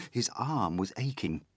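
plain reading voice

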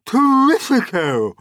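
high, loud exclamation of delight; ecstatic cry. A narrator's reading. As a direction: cry out in rapture. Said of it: "Aunt Sponge cried out".

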